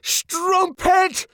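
high, furious cry; furious outburst. A narrator's reading. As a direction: rage at her like a madman. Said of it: "rushed at her like a madman".

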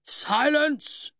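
high, forceful sergeant's shout; drill-sergeant roar; bellow. A narrator's reading. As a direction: bellow for silence over a quarrel. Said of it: "Sergeant Horwich bellowed".

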